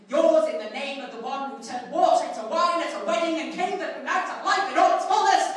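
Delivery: loud, high, pompous proclamation; hammed-up ceremonial flourish; grand bishop sign-off. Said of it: "he signed off letters with".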